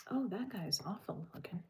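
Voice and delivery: soft whisper under the breath; whispering a comment on the photo; whispered remark. Real, not acted. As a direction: murmur it so no one hears.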